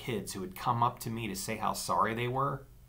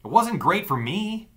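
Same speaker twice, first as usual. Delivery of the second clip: bitter; 10 semitones higher, 7 dB louder than usual. A narrator's reading. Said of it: "I asked bitterly".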